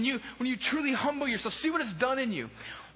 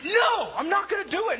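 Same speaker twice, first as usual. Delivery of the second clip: loud, high flat refusal; mock indignant shout of refusal; 6 semitones higher, 4 dB louder than usual. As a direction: refuse it loudly, fed up.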